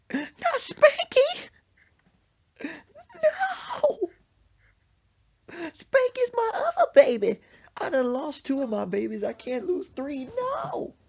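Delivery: very high, loud, drawn-out mock crying; hysterical wailing. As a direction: plead for your baby in an acted screech. Mama wailing over her pet bird.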